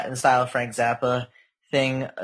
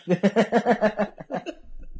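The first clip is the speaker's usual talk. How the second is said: high laugh; 7 semitones higher than usual. Real, not acted. Laughing after quote.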